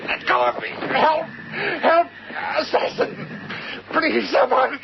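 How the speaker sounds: breathless, high, panicked calls; desperate cries for help. Acted. Harry wrestling the thing, crying for help. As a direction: scream for anyone to come.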